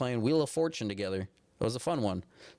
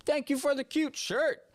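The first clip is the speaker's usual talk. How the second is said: very high, cutesy thank-you; high squeaky thanks; 13 semitones higher than usual. Real, not acted.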